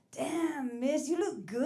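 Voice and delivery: a hint of a street catcaller; catcalling guy. Construction guy hollering at the comedian.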